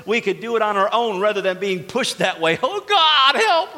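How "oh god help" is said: high, loud pleading "Oh, God, help"; desperate cry to God. Acted. A stubborn believer pushed to God, crying out for help. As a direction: cry out like a drowning man.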